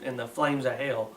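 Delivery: ordinary delivery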